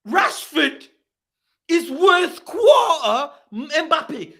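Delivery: high, disbelieving cry with drawn-out words; scandalised high-pitched protest; incredulous outrage. Real, not acted. Outraged at a player valuation.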